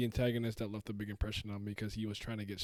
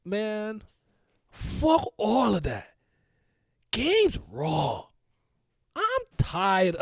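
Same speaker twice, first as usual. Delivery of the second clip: higher, slow, loud put-on friend's voice; Simi voice; 8 semitones higher, 6 dB louder, much slower than usual. Friend on stream slips into Simi's voice, higher and slow. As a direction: do your buddy's lazy drawling gripe. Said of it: "why do you keep bringing out the Simi voice".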